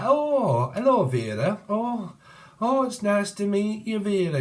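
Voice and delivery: high-pitched northern English greeting; northern English accent. Acted. Greeting Vera as someone from up north, high northern English voice. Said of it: "it could be from up north".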